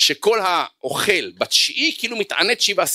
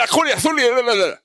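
higher, agitated mock complaint; angry student; 7 semitones higher than usual. Angry student venting to the rabbi, higher agitated complaint. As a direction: spill out grievances in a huff. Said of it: "הוא אומר".

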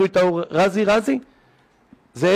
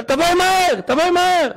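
urgent call; 11 semitones higher than usual. Retold or imitated. Caller urging wife to hurry.

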